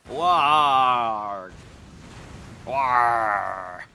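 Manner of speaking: loud hammed-up war cry; battle shout. Sailor raises the alarm, loud hammed-up war cry. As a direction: bellow it across the deck.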